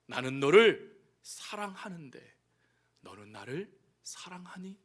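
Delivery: low, slow, fading to a whisper; tender. Acted. Jesus asking Peter, tender and hushed. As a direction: ask softly as a loving Lord.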